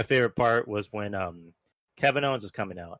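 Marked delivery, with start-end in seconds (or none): none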